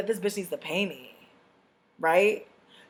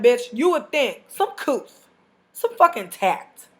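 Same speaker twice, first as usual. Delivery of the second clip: usual speaking voice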